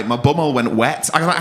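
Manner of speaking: ordinary delivery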